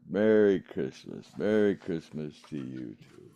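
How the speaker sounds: ordinary talk, nothing marked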